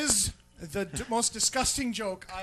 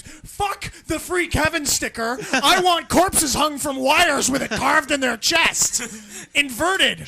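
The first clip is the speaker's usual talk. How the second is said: loud, slow ranting shout; 4 semitones higher, 7 dB louder, slower than usual. Pretend. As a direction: bellow the demands like a fanatic. Ranting demands about cult revenge.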